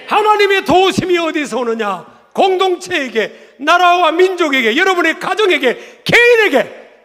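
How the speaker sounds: high, loud climactic proclamation; forceful preaching. Real, not acted. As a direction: build phrase by phrase at full force. (절정으로 치닫는 설교 외침)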